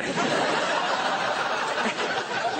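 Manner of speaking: breathless high wizard cackle; wizard laugh. Acted. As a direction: let out a mystical laugh.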